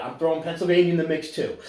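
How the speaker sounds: usual speaking voice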